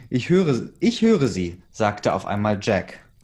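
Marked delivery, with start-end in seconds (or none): 0.1-1.6 calling out. A narrator's reading. Rescuer calls out.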